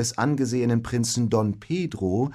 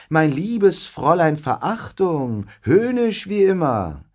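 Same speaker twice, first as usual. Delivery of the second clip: high, sneering mock courtesy; 6 semitones higher, 3 dB louder than usual. A narrator's reading. Benedikt mocks Beatrice with a high sneering politeness. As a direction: lay the sweetness on to mock her. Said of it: "lachte Benedikt spöttisch".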